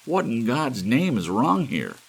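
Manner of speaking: high, loud panicked cry; hysterical shout. Acted. Trapped druggist crying out to the frozen store, high and hysterical. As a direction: shriek it out in terror. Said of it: "high, hysterical tenor".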